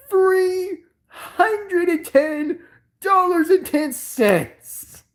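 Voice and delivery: slow, high squealed price; hammed-up incredulity; mock shock. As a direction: stretch each word in a high wail. Repeats the shocking price in disbelief.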